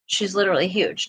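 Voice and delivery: ordinary talk, nothing marked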